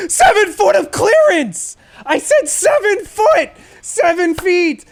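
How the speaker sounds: high, loud, furious retold shouting; seven feet yelled in fury; angry yell. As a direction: yell it at the tow driver, fed up. Yelling at the tow driver.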